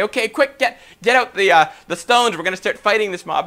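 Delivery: rally your friends for a scrap; urgent rallying cry. Acted. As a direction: pretend urgent plotting. Imagined rallying cry to fight back.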